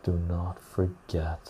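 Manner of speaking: solemn quiet do not forget; slow soft repeat. Shown as a demonstration. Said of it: "repeat fading".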